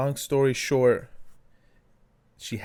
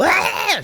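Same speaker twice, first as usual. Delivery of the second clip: sharp high wail, much louder; baby scream imitation; 9 dB louder than usual. Mother imitating her baby's discomfort cry, high shriek. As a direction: mimic a baby's sudden shriek. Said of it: "It's not like".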